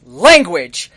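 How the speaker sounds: sharp loud scold of Language; mother snaps at her cursing son. Acted. Mother scolds her son for cussing, loud sharp rebuke. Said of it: "your mother turns and looks at you and says".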